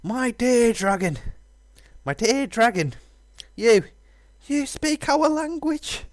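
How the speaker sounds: high, drawn-out awed voice; high character voice. Acted. Voicing game dialogue.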